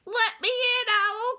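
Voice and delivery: very high, loud mouse call. A narrator's reading. Mouse outside calling to Owl, high and loud. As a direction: squeal for the door to open. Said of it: "called Mouse".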